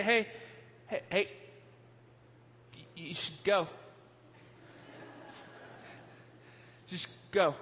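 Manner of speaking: soft, low, hesitant coaxing; calm and kindly. Retold or imitated. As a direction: gently urge with long careful pauses. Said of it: "very calmly, kindly".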